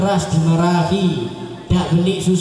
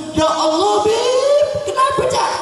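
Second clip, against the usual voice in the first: shocked friend; 13 semitones higher than usual. Acted. Cak Rijal shrieking at the thin woman's photo. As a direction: shriek in mock disgust.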